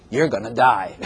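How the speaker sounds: low, offhand doom pronouncement with a laugh; blunt death warning. Acted. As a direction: tell them bluntly, breaking into laughter. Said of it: "don't say it like that".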